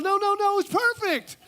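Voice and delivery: mock-girly flattery; overdone sweet friend; gushing woman. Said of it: "woman number one".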